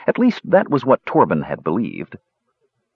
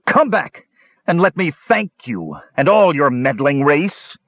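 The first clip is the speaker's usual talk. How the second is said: high raging shout, sneering; raging hobgoblin; 5 semitones higher than usual. Acted. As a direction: rage and sneer after him. Grog keeps raging.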